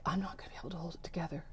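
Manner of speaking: breathless whispered panic; panicked whisper. Retold or imitated. Whispering to herself before breaking down. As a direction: whisper it fast, fighting back tears.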